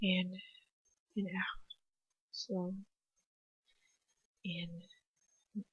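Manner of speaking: very soft, halting, spaced-out words; breathing through pain. A narrator's reading. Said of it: "I have to keep breathing".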